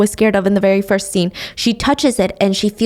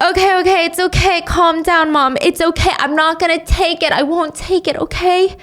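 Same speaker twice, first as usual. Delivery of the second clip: higher, soothing and pleading; calming mom down; 7 semitones higher than usual. Acted. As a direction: settle a panicking mother. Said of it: "So Sonny has to be like".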